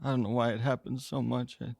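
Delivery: halting, subdued voice; downcast, with long pauses; slow, low tone. Acted. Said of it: "slow, low tone".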